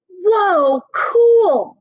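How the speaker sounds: put-on high, loud kid's wow; excited whoa. Emilio sees the photograph of the houses and says it with loud high wonder. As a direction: gasp of delight at the picture.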